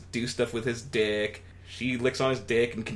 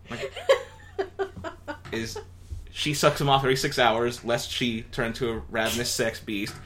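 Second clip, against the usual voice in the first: usual speaking voice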